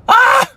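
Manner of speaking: sudden loud high groan, half-joking; mock groan on waking. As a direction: groan like the alarm just went off.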